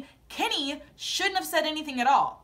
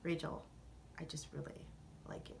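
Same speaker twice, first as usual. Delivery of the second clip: much softer, lower creepy murmur; mocking the creepy suitor, soft and low; creepy Jack; 8 semitones lower, 19 dB quieter than usual. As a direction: imitate a creepy admirer. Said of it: "Jack likes to do this".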